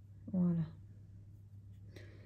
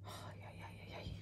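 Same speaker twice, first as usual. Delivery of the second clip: whispered dismay; 25 dB quieter, much faster than usual. Real, not acted. Eyeshadow powder falling everywhere.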